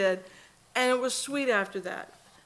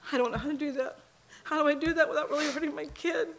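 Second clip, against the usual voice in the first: pleading with God; 6 semitones higher than usual. Retold or imitated.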